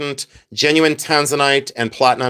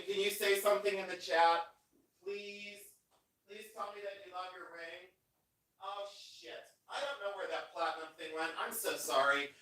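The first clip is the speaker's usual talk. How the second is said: far from mic; 5 semitones higher, 20 dB quieter than usual. Real, not acted. Steps away from the mic.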